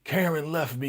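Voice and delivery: high, self-pitying whine; mock heartbroken whimper; whining.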